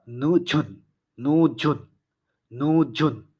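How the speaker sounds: 늦은 spoken slowly; Korean pronunciation. Shown as a demonstration. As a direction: say নুঝুন clearly with জ not ঝ. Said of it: "জয়ের মতো উচ্চারণ হবে".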